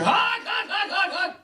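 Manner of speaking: high shouted goose honks; goose honking. Retold or imitated. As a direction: squawk out honks like an angry goose. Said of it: "And the geese are".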